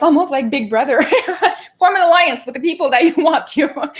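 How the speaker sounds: laughing through the joke. Real, not acted. Joking to viewers about a Big Brother alliance, laughing. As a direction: chuckle through it, amused.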